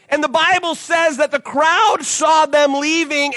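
ordinary talk, nothing marked

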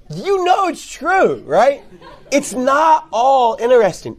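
high, slow laughing aside. Real, not acted. Admitting to the audience that the Bible isn't all interesting, laughing. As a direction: laugh while conceding the point.

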